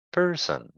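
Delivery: "Person" with PER high, son falling; first syllable stressed. Shown as a demonstration. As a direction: put the weight on PER in "Person". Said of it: "The first syllable is stressed".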